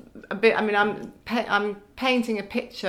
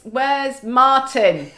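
slow, drawn-out call for Martin; a hint of a confused old woman calling out; mimicking a confused ward patient; lost patient calling; 5 semitones higher, 6 dB louder, much slower than usual. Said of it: "wandering around saying".

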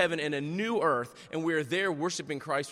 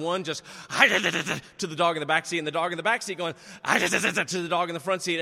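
dog snarl imitation; 5 dB louder than usual. Pastor mimics his dogs fighting in the truck with snarls. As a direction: snarl like a dog mid-sentence, mocking the fight.

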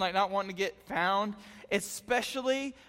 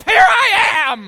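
loud, slow, laughing shout; mock shout; 12 semitones higher, 16 dB louder, much slower than usual. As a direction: blurt it out as if giving yourself away. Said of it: "you wanna be like".

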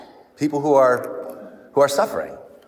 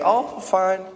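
light, higher-pitched Southern drawl; Southern grandma; 6 semitones higher than usual. Retold or imitated. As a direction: imitate an old Southern lady, pitched up. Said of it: "in her southern voice".

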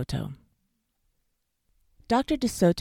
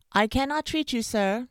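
high, raised-voice refusal; shouted; 8 semitones higher, 5 dB louder than usual. A narrator's reading. Dentist turning away a fox at the door, shouted. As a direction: call it out loudly and firmly. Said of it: "Doctor DeSoto shouted".

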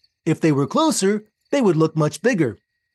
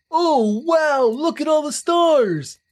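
excited; 8 semitones higher, slower than usual. Acted. Sees stars, shouts in wonder.

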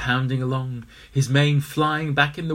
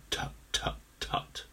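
soft breathy tut tut; disapproving tutting; 9 dB quieter, slower than usual. Acted. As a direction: tut under your breath. Disapproving club member.

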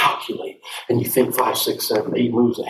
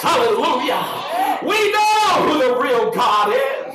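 high, loud shout of praise; preaching shout; 11 semitones higher, 3 dB louder, slower than usual. Real, not acted. Preacher rallying the church, shouting high and loud. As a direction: cry it out to the congregation.

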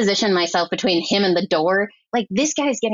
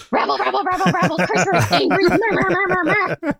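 high-pitched cartoon grumbling; mock angry ranting; 11 semitones higher than usual. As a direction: imitate a man cursing in nonsense syllables. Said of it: "starting to yell and curse at me".

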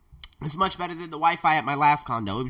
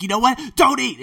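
mock angry snap; 8 semitones higher, 9 dB louder than usual. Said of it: "She's getting mad".

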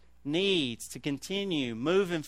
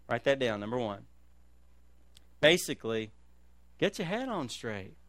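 ordinary talk, nothing marked

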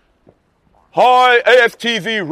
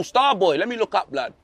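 normal talking voice

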